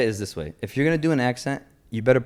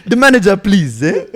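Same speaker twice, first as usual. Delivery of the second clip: put-on loud, high Nigerian accent; 6 semitones higher, 7 dB louder than usual. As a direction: demand the manager in a Nigerian accent. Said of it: "The Nigerian one is so good".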